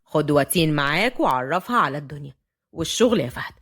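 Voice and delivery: very low-pitched, authoritative; commanding grandfather. A narrator's reading. Grandfather orders Fahd to take Watin, commanding. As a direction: give a measured elder's order. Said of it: "الجد بنوع من الأوامر".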